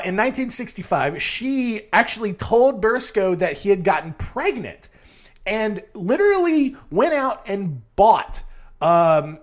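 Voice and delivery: ordinary talk, nothing marked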